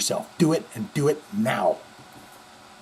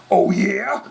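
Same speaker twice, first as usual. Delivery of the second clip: higher, growly rock-star come-on; 5 semitones higher than usual. Retold or imitated. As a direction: purr it like a rock star on stage. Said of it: "that's the [name]".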